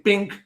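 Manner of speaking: high-pitched "bink"; short high sound effect; comic impact noise. Retold or imitated. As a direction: pop it out as a cartoonish bonk. Imitates head hitting the railing.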